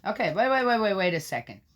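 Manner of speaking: excited halt; urgent interruption. Real, not acted. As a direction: stop yourself mid reading. Interrupts his own reading.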